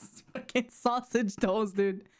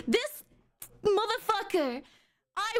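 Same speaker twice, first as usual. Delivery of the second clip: exasperated outburst; 7 semitones higher than usual. Real, not acted. S1 outraged at S0.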